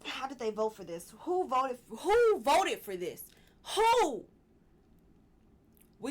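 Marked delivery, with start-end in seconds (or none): 1.2-4.2 real outrage, louder and higher, rising to a yell; angry cry of who voted for this; worked up. Real, not acted. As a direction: demand an answer, exasperated. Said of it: "I'm getting worked up".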